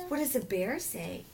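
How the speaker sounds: slow, high singsong question; reading to a toddler. Acted. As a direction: ask it like to a little child. Parent quizzing toddler on animals.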